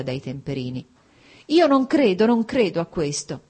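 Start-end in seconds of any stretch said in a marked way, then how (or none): 1.5-3.4 loud, much higher outburst on io non credo; exclaiming in disbelief. A narrator's reading. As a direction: burst out in denial. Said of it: "esclamò Dolly". (esclamazione incredula, molto più acuta e forte)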